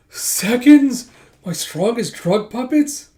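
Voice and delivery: hammed-up disbelief; shocked villain. Villain hearing his men lost, mock shrieking disbelief.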